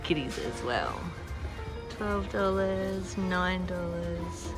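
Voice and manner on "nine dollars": normal talking voice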